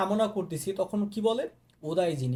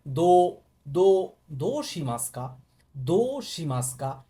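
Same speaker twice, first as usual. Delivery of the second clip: দো hit hard and louder, দো শিমাস্কা slow; stress on দো; 3 semitones lower, 3 dB louder, slower than usual. Shown as a demonstration. Teacher drills students, punching দো. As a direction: lean on দো, then slowly দো শিমাস্কা. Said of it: "জোর দিয়ে বলবেন".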